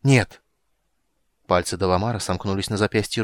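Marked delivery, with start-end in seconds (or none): 0.0-0.4 sharp, loud, higher no; loud cry. A narrator's reading. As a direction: cry out in refusal. Tanis cries out refusal.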